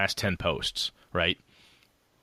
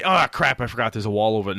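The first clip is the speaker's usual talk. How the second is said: sudden mock alarm; 3 semitones higher, 5 dB louder than usual. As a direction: act out suddenly catching a mistake. Acted player realizing a mistake.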